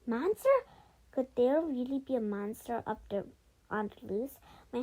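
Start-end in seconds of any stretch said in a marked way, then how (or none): 0.1-0.5 loud, high startled question; acted jolt of panic; panicked question. A narrator's reading. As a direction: blurt it in alarm, pitch jumping up. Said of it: "looked around in panic".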